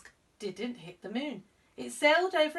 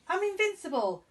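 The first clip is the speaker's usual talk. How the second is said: higher, proud voice; boastful young god; 10 semitones higher, 4 dB louder than usual. Acted. Ganesh boasting to Mister Mouse, a higher proud voice. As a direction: brag like a cocky kid.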